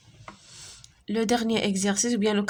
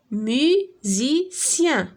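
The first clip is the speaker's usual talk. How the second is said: slow model word; 3 semitones higher, much slower than usual. Shown as a demonstration. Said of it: "Écoute et repère le son".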